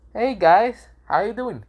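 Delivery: put-on high, bright greeting as a carefree boy; cheery Boruto voice.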